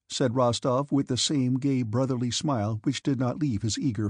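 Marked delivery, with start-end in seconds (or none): none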